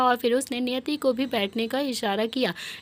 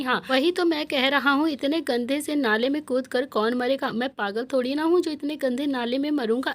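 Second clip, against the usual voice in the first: plain reading voice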